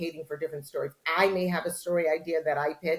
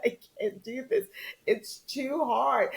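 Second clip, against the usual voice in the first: slow, high mock whine; a touch of self-mocking misery; defeated; 9 semitones higher, slower than usual. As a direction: whimper it out, hammed-up despair. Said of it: "And I said".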